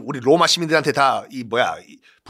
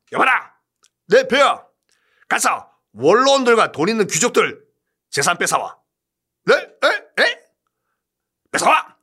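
emperor giving orders; 7 semitones higher than usual. Acted. Emperor and servant dialogue.

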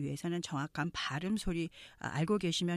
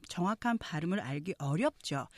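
normal talking voice

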